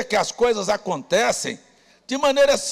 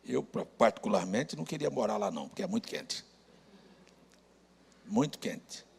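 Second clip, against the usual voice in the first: much softer and lower, offhand; low-key aside; 11 semitones lower, 7 dB quieter than usual. Real, not acted. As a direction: drop right down for a private joking remark. Joking personal aside to congregation. (comentário à parte, baixo e grave)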